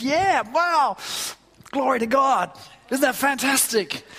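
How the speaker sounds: high, exuberant mock celebration; excited convert. Convert boasting to his horrified pastor, wildly excited. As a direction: play a new convert thrilled with himself. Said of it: "he's all excited".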